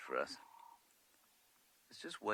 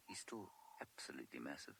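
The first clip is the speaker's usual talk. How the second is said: hushed, awed whisper; 4 semitones lower, 8 dB quieter than usual. Real, not acted. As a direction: keep it to a breath. Near elephants, keeping quiet.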